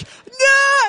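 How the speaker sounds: very high loud shocked cry; hammed-up astonished shout. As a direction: gasp out your disbelief. Man's shocked exclamation.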